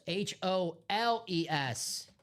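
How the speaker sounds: "H O L E S" spelled slowly, each letter separate. Shown as a demonstration. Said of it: "But holes".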